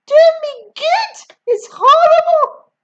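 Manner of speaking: very high, loud scream of the word. A narrator's reading. Grandma rejecting George's praise, very high loud scream. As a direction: scream it in disbelief. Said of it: "she screamed".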